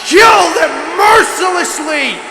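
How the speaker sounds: slow shouted threat; shouting. Acted. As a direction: bellow each word slowly. Bloodthirsty war cry in game.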